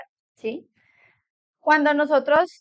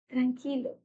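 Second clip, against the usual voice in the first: hushed dismissive tranquilo; 11 dB quieter than usual. Shown as a demonstration. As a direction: mutter "Tranquilo" as if to hush a crying person. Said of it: "Imagínese usted llorando, estresado y que le digan".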